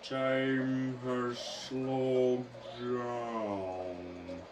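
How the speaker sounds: stretched-out, very slow slow-motion voice. Pretend. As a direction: drag every word as if time has stopped. Dramatic slow-motion line.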